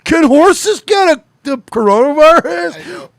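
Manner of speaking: very high, slow, hammed-up yell; over-the-top drawn-out holler; mock frantic typing. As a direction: shout the search query in mock panic. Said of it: "That's what you're typing into Google".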